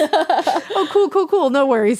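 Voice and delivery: high, laughing mock calm; laughing sarcastic. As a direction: laugh through a fake no worries. Sarcastic panicked inner thought.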